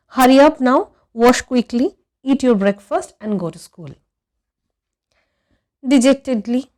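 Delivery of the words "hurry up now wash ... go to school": louder, hurrying mother; bossy mother. A narrator's reading. A mother hustling her boy off to school, briskly. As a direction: order him off to school.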